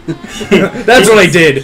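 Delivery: drawn out through laughter; slow laughing. Real, not acted. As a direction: laugh it out slowly. Laughing while joking.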